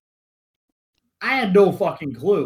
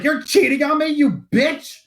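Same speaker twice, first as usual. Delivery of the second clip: staged yell; 7 semitones higher, 4 dB louder than usual. Retold or imitated. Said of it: "you're gonna yell".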